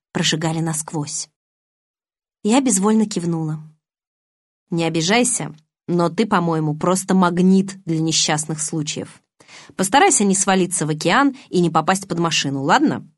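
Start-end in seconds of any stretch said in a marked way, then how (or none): none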